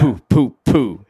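popped "poo" gunfire noises; imitated gunshots. Retelling Santa being shot, imitated gun pops. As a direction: punch out three mock gunshots.

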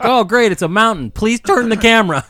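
high, loud sarcastic groan; mock the endless mountain shot; sarcastic complaint. Viewer bored by a scenery shot, loud sarcastic whine.

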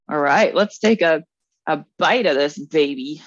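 ordinary talk, nothing marked

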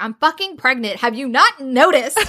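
mock-exasperated, slowing on the question; playful snippy retort, rising in pitch; pissy. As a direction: act out a fed-up pregnant woman. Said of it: "she's so pissy".

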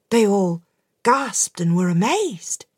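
plain reading voice

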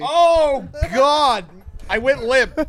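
loud, high exasperated cry; exasperated outburst. Real, not acted. Worked up mid-argument, loud exasperated groan of disbelief. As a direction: throw your hands up in loud disbelief. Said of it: "I'm so angry".

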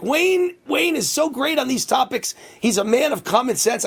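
high-pitched mock proclamation; imitating Trump. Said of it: "And he's like".

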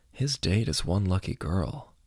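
soft, private musing; inner thought. A narrator's reading. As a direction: think it to yourself, quietly. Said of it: "he thought".

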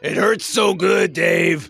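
high, hammed-up moan of pleasure; mock pain. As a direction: play it like a sweet ache.

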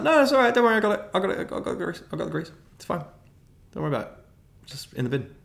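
high, rushed soothing with a guilty edge; calm her down while panicking inside; nervous placating. Retold or imitated. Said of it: "And I was like".

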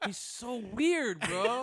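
lingering Mahomes voice. Retold or imitated. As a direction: drag it out still in the squeaky voice. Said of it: "still doing the voice".

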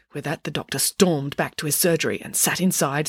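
plain reading voice

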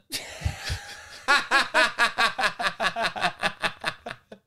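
extended laughter rising; long high laugh. Real, not acted. Teller laughs at own joke's punchline, long and high.

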